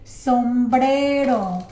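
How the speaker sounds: "Sombrero" stretched out loudly; drawn-out, projected syllables; slow model pronunciation. Shown as a demonstration. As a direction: model "Sombrero" slowly and emphatically. Teacher models a vocabulary word.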